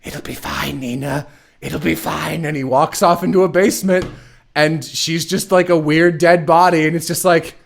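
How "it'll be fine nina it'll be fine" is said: much softer, hushed reassurance; mock soothing. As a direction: pet a child and calm her quietly. Scientist soothing his creation.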